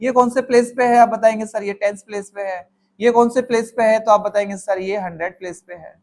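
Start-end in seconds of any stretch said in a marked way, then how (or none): 1.5-2.7 soft, much quieter student reply; student answering. Pretend. Teacher voicing a pupil's reply to sir, much quieter. 4.6-5.9 soft, lower, much quieter pupil reply; student answering. Pretend. Teacher voicing a pupil's reply to sir, softer and lower.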